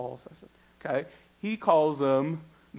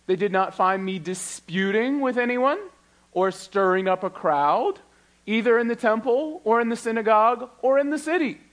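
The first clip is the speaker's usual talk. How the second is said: dramatic scripture reading; 7 semitones higher, 6 dB louder than usual. Acted. Reading Paul's defense aloud.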